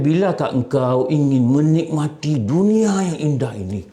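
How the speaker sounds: alluring, sweet-talking voice; seductive tempter. Acted. Desire seducing the listener with the beautiful world, alluring. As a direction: make it sound irresistible. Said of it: "dia punya intonasi tu, betul-betul menarik".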